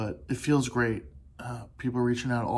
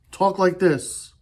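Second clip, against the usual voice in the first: "Talk like this." said slowly, higher and louder, leaning on "like"; slow emphatic correction; 5 semitones higher, 6 dB louder, slower than usual. Shown as a demonstration. Telling friends how to answer a cry for help, slow and emphatic. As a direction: spell it out slowly, pressing "like". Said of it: "Don't do that".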